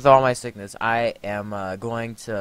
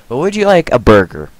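much louder, booming sales pitch; booming offer; 2 semitones higher, 13 dB louder than usual. Pretend. Player looming over a tiny burger customer, booming offer. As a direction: boom it out at the customer.

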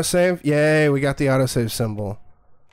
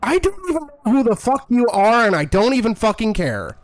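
angry yelling; 8 semitones higher than usual. Real, not acted. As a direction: snap back hard at the taunting voice. Yelling at game villain.

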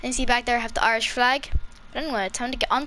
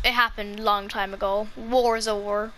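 normal talking voice

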